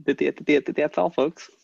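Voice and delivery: lightly voiced stuttering cartoon farewell; a hint of cartoon stutter; stuttering cartoon sign-off. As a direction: stammer into a jokey cartoon goodbye.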